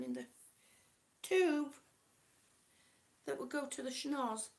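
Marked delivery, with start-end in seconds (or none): none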